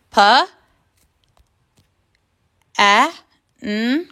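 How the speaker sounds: pancake sounded out letter by letter; sounding out. Shown as a demonstration. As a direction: stretch each sound of the word apart. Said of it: "let's sound out the word pancake".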